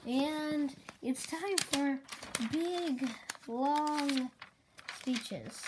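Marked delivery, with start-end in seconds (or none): 2.5-4.3 slow, drawn out big, long; drawn-out emphasis; stretched words. Real, not acted. Mocking long speeches.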